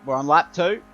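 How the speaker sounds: excited race commentary; motorsport commentator. Acted. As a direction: call the race live, building up. Live race play-by-play.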